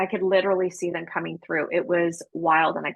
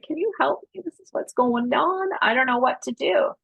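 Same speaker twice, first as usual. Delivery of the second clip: pleading for help; 7 semitones higher than usual. Retold or imitated. Begging minister for help, retold.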